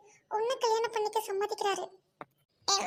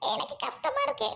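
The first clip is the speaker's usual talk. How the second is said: ordinary talk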